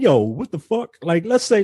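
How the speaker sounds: ordinary delivery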